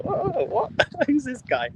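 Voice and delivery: woman startled to meet a foreigner on the mountain; shocked. Retold or imitated. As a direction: play a startled villager, half laughing. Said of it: "She looks really shocked".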